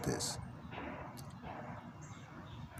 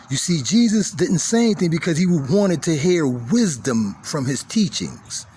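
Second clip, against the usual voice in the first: louder, higher preaching at full force; fiery preaching; 9 semitones higher, 8 dB louder than usual. Acted. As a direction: build the sermon to its peak. Sermon climax continues.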